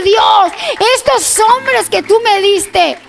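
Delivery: high, slow, fervent offering; impassioned prayer. Retold or imitated. David offering his men's gift to God, impassioned prayer. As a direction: cry it up to God, slowly and fervently.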